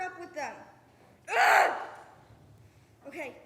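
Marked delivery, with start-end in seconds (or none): 1.3-1.7 sharp loud groan of frustration; frustrated groan. Acted. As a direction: let out an angry, exasperated ugh. Frustrated outburst after self-blame.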